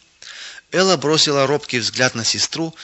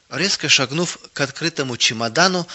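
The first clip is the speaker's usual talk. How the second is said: ordinary delivery, nothing marked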